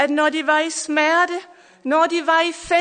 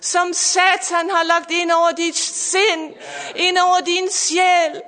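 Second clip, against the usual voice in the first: building, louder sermon cadence over music; fervent preaching; 2 semitones higher, 4 dB louder than usual. Acted. Pastor preaching to listeners online, fervent and rising.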